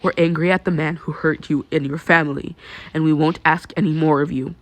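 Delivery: ordinary delivery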